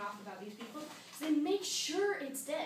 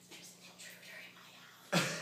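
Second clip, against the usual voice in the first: breath-only hushed report; whisper; 10 semitones lower than usual. Acted. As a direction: whisper as if hiding from an intruder. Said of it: "they whisper it so".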